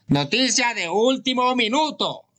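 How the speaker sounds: high-pitched breaking-news announcement; urgent newscaster's call, high and ringing; news flash. Pretend. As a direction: announce a bulletin, pitched well up.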